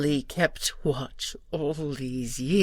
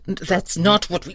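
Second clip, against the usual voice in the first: higher, louder cut-off protest; alarmed protest; 6 semitones higher, 7 dB louder than usual. Acted. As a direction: burst out in alarm. Guardian protests the decision.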